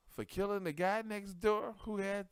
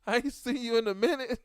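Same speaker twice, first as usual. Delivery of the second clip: loud, higher, sweet-talking pickup line; smooth flirting; 6 semitones higher, 9 dB louder than usual. Retold or imitated. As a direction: charm her back after a fight. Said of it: "I was looking like".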